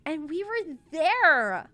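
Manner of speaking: slow, loud, high proclamation; proud and excited. Acted. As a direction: savor the moment loudly. Mars proud announcement.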